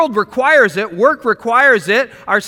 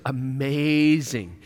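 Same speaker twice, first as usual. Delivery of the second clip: mock amazement; 6 semitones lower, 5 dB quieter, much slower than usual. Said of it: "God looks down and goes".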